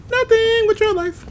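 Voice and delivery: very high, slow squeaky voice; squeaky character voice. Acted. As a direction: squeak it like a cartoon. Quoting a game line.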